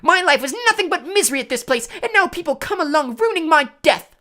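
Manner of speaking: very high, loud wail; howling. A narrator's reading. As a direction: wail the misery at full pitch. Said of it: "howled Myrtle".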